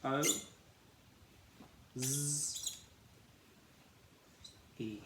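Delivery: k, o, z, e said apart; sounding out cozy. Shown as a demonstration. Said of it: "And we say k".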